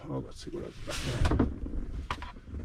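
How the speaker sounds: whispered aside to himself; muttered under breath. Real, not acted. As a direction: mutter it quietly while searching. Muttering to self while rummaging.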